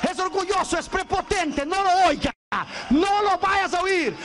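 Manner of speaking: desperate devil begging God. Retold or imitated. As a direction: plead with God to ignore him. The devil begging God not to listen.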